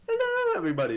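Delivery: squeaky high mock channel greeting; youtuber intro. Copying a friend's youtuber intro as a squeaky mock greeting. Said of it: "This is Tomby".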